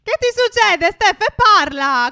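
loud, urgent questioning; alarmed demand. Pretend. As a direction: raise your voice and demand an answer. Alarmed, demands that Steph speak. (domanda allarmata a voce alta)

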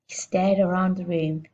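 usual speaking voice

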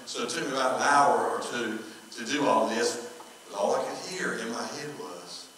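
ordinary talk, nothing marked

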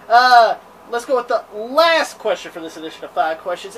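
ordinary talk

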